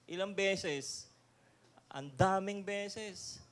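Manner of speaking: ordinary delivery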